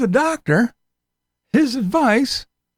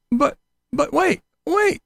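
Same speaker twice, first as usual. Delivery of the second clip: mock teaser; 7 semitones higher than usual. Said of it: "mock infomercial build-up".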